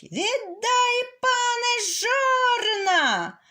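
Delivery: high, loud rooster demand; rooster shouting. Acted. Rooster at the manor gate, shouting for the millstones. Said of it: "зайшов і кричить".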